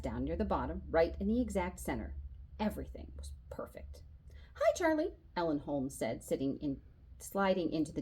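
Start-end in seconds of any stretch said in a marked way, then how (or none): 2.6-4.0 whispered, pleased with the tidy desk; satisfied whisper. A narrator's reading. Boy admiring his neat desk, soft whisper. 4.5-5.1 high cheerful hello; bright girl greeting. A narrator's reading. Ellen greets Charlie, high cheerful hello.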